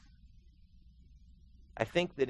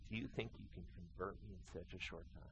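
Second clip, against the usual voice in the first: normal talking voice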